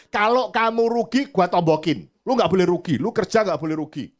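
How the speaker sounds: loud, high insistent lecture; emphatic advice. Retold or imitated. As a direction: drive the point home firmly. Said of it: "Saya bilang".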